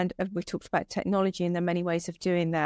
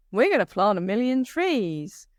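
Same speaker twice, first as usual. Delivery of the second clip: playful mock American accent; light-hearted imitation of an American accent; 3 dB louder than usual. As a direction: send up an upbeat American tree-planting pledge in an American accent. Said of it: "that was my American accent".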